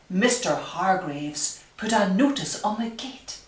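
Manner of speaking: ordinary delivery, nothing marked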